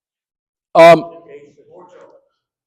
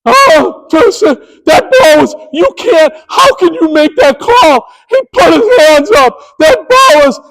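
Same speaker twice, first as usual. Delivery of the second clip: furious coach; 9 semitones higher than usual. Retold or imitated. Coach yelling at the umpire over the foul call, high and breaking. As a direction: plead and yell at once, voice breaking.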